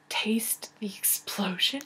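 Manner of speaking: slow hushed tagline; slow teasing whisper of the slogan; mock whisper. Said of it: "I think it says something like".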